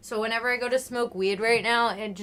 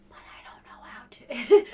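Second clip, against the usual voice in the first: whispered admission. Real, not acted. Trails off, sheepish.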